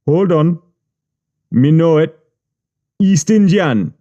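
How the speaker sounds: high Jamaican voice, pondering; thinking Jamaican patois; Jamaican accent. Retold or imitated. Boss voiced in story.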